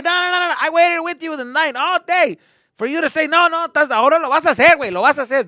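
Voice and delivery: loud, high-pitched rant; outraged dad. Acted. Dad to son who backs out, ranting loudly. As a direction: yell over the kid, acted fury.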